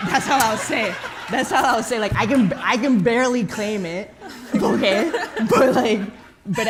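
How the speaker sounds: high, loud laughing delivery. Real, not acted. Laughing at herself while admitting she can barely claim it. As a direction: let the laugh take over each phrase.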